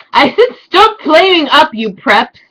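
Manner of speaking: high, loud mock indignation; indignant rant. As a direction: yell it like an outraged teen author. Acts out the author's angry note.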